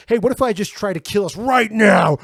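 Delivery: high, shouted, crazed-happy dog; manic dog voice. Retold or imitated. Friend voices a reckless dog climbing into the front seat, high manic shout. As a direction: play the dog who loves you while crashing the car.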